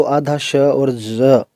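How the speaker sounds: slow, drawn-out ज between श and ज; zh sound demo. Shown as a demonstration. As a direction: blend half a श into ज slowly. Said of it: "जैसे हमने जेड में बोला था ज".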